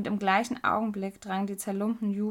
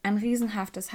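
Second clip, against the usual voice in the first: plain reading voice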